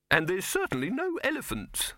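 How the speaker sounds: high, irritated retort; snapped objection. A narrator's reading. Silverfish refusing Dibbler's elephants, high and irritated. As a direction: snap it testily. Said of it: "snapped Silverfish".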